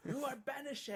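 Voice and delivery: high, loud, hammed-up royal proclamation; mock banishing decree. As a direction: cast them out like a pompous king.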